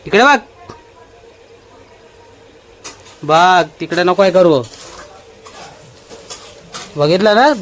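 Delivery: loud, firm threats to the dog; stern scolding. Real, not acted. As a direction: lay down the law to the pet. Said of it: "धाक तर पाहिजेच त्याला". (कुत्र्याला धाक दाखवणारा, मोठ्याने दटावणारा आवाज)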